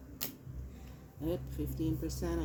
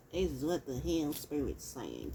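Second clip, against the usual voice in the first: normal talking voice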